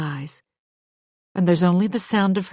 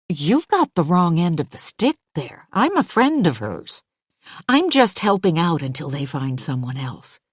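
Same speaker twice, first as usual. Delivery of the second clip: ordinary delivery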